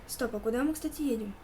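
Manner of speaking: usual speaking voice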